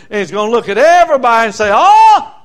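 rising from loud to a high shouted oh; building shout. Acted. Preacher to congregation, swelling into a high shout. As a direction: build the crowd up to a big cry of oh.

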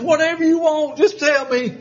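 high, pleading and laughing; a grandpa melting before a toddler; mock pleading surrender; caving grandpa. Giving in to granddaughter.

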